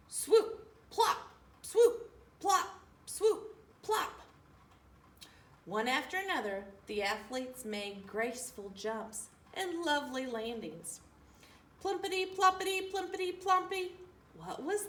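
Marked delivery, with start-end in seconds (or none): 0.1-4.2 high, loud playful swoop-plop. A narrator's reading. Narrator mimicking ski jumps, high bouncy swoop and plop. Jumpers landing sound words. 11.8-14.0 high bouncing plumpity-ploppity; plumpity sounds. A narrator's reading. Narrator voicing Tacky's flopping fish, high and bouncy.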